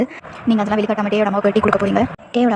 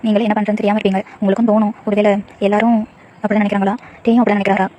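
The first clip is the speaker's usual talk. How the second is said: usual speaking voice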